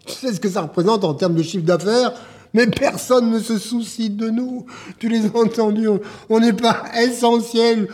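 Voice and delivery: exasperated tirade building to high cries; angry shouting. Acted. Man berating club owner over cancelled contracts, shouting angrily. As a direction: shout out your despair at being ignored. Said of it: "Arrête de crier!".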